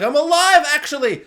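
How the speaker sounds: high, loud triumphant burst; excited exclamation. Acted. Billy revealing he survived, acted loud excitement. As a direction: burst out with acted good news.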